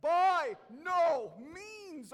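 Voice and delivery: slow, loud, high shout of "By no means". Shown as a demonstration. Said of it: "Say it louder".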